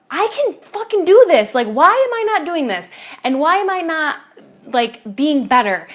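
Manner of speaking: high, loud frustrated outburst at herself; exasperated inner rant; frustrated self-talk. Retold or imitated. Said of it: "thinking about".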